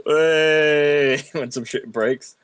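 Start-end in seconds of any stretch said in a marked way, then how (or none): 0.1-1.2 loud, drawn-out high "hey"; crowd cheer. Shown as a demonstration. Brit demonstrating the pub reflex when glass breaks, drawn-out cheer. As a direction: hold it out like a whole bar cheering. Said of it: "everyone just automatically goes".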